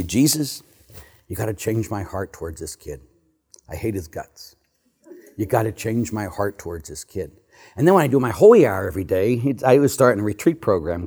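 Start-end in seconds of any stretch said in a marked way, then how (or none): 0.0-7.3 hushed, pleading prayer to Jesus; quiet retold prayer. Priest alone at dawn, pleading with Jesus, hushed. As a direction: murmur it like a private confession. Praying alone in his chair.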